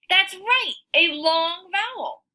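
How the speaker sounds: delighted teacher praise; excited praise. Acted.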